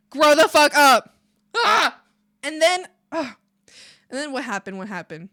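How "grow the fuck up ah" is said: loud, slow, emphatic yell ending in a groan; exasperated outburst. Real, not acted. Venting at the whiny guest, exasperated yell. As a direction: let the frustration burst out.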